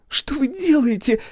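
high, slow startled cry. Acted. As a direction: cry out in alarm. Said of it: "вскрикнула та".

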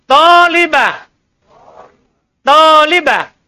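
teacher sounding out "Tolibah" clearly, syllable by syllable; model pronunciation. Shown as a demonstration. Teacher models the Arabic word for pupils, loud and slow. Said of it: "Jadi lihat, perhatikan, ulang".

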